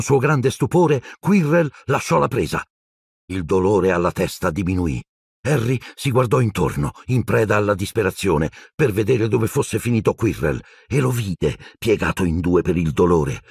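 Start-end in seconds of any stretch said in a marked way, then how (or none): none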